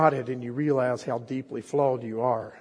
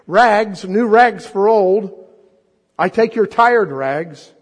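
loud, high ringing tenor cry of a rag seller; street call; 7 semitones higher, 11 dB louder, much slower than usual. Acted. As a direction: sing it out down the alley. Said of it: "calling in a clear tenor voice".

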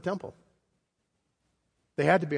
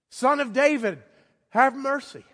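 higher, louder plea; calling out again; 9 semitones higher, 4 dB louder than usual. Retold or imitated. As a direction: call it out again, higher and pleading. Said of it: "And he said it again".

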